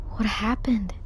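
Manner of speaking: breathless, soft whisper of disbelief. Real, not acted. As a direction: whisper as if afraid to break the moment. Dramatic cutscene moment.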